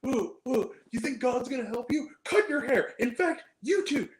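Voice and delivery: high-pitched imitation of a ranting bully; mock berating. Imitating the monster berating prisoners in their cells, mock rant. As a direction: berate everyone in a hammed-up high voice. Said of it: "it's just berating and, like, shoving people".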